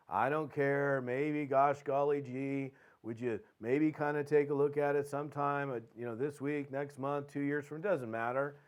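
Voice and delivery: half-hearted, noncommittal mumbling invite; lackadaisical. Acted. Salesman asking them to look sometime, lackadaisical. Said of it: "versus the lackadaisical".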